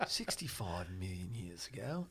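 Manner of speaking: low, slow, soft English documentary narrator; amateur English accent, slow and quiet; English accent impression. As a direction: attempt a hushed English nature-film narration. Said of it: "saying it with that beautiful English accent".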